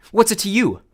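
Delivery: high, loud defiant snap; angry retort. A narrator's reading. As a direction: bite back at the bully. Percy snaps back in anger.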